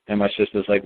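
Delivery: ordinary talk, nothing marked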